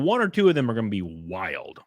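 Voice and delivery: ordinary delivery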